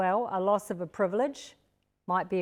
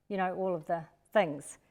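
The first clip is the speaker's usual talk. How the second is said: usual speaking voice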